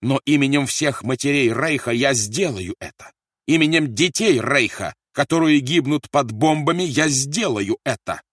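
loud, high, ringing vow; fierce declaration. A narrator's reading. Officer vows it in the Reich's name, fierce declaration. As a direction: proclaim it like a fanatic.

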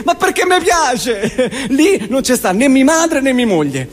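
ordinary talk, nothing marked

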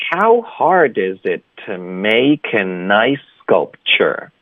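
"How hard is it to make a nice sculpture" slow, linked; slow linked model. Shown as a demonstration. Teacher modelling the whole sentence for students, slow and linked. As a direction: draw out each word, running them together. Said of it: "所以整个句子就是".

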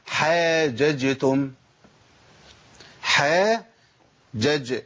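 very slow "حاججتم", open jim then echoed still jim; stretched "aa", then "ja-j" in "حاججتم"; both jims distinct. Shown as a demonstration. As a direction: separate the two jims of "حاججتم". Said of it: "جيم مفتوحة وبعد كده جيم ساكن".

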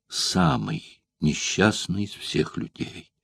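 slow, whispered lament; deep grief. A narrator's reading. Spartacus mourns his fate, a slow whispered lament. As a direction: breathe out the words in despair. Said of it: "голосом, в котором звучало глубокое горе".

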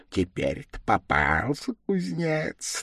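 scheming demon aside; devil thinking to himself. A narrator's reading. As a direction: gloat privately over the catch. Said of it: "думал он про себя".

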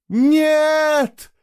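high, loud, drawn-out wail; wailing cry. A narrator's reading. Girl watching both boys vanish into the portal, wailing. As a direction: cry out in despair. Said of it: "заплакала она навзрыд".

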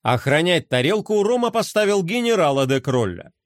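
usual speaking voice

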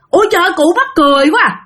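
put-on father scoffing at the old man and sending him off; mocking scorn. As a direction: laugh him off and shoo him away. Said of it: "cái ba nói rằng".

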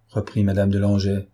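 ordinary delivery

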